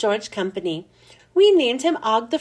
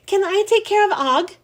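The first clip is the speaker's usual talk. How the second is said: loud, high eager question; an excited boy asking loudly; 8 semitones higher, 4 dB louder than usual. A narrator's reading. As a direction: blurt it out loudly. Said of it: "A J asked loudly".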